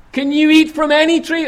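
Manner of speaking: high, loud leading question; probing tempter. Acted. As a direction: lure her into agreeing. Voicing Satan's probing question.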